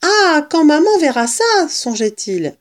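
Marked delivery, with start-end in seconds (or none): 0.0-1.4 high, loud, gleeful exclamation; thrilled child's voice, raised high; overjoyed. A narrator's reading. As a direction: burst out in delighted anticipation. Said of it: "Il était fou de joie".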